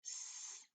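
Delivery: S as a hiss, no voice; S whispered as its sound; sounded out letter. Shown as a demonstration. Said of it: "We have".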